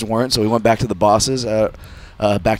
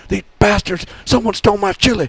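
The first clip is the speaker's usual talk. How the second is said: high-pitched mock outraged yell; Wild Bill flipping out; 12 semitones higher, 4 dB louder than usual. Wild Bill raging over the walkie-talkie, mock high outraged yell. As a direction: imitate a man losing it, pitched way up. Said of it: "he flips out once he notices his chili's gone".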